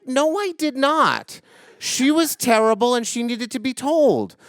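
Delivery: teacher digging in against the class, high and stubborn; stubborn insistence. Retold or imitated. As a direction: refuse to back down, each word firm.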